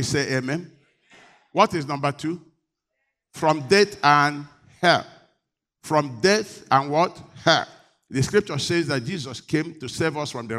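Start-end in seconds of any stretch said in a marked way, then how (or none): 3.3-7.2 slow call. Real, not acted.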